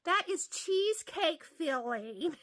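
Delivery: eyes wide, drawing the words out in shock; surprised. Retold or imitated. Waitress realizing it is cheesecake filling, slow loud astonishment. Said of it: "her eyes got big as saucers".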